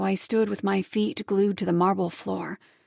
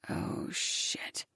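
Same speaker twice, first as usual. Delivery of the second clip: under-the-breath whisper; whispered curse; 13 dB quieter than usual. A narrator's reading. Narrator caught off guard, whispered curse.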